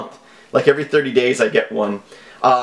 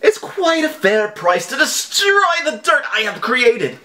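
higher, slow, hammy menacing read; overacted scammer read; 8 semitones higher than usual. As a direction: ham up the villain's demand. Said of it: "I read that a little too".

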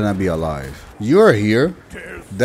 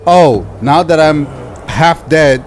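loud, exasperated outburst; fed-up gamer complaining loudly; mad; 2 semitones higher, 4 dB louder than usual. Real, not acted. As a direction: raise your voice in real frustration, stretching the oh my god. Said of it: "I am mad".

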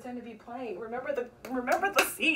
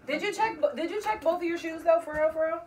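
loud mock concern; 6 semitones higher, 7 dB louder than usual. Feigning concern loudly.

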